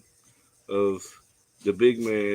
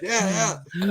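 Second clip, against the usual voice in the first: high, laughing mock coaxing; sleep-talking friend; 8 semitones higher than usual. Retelling buddy flagging in his sleep, high laughing mimicry. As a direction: imitate a dreaming flagger waving cars on. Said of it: "he was doing both hands".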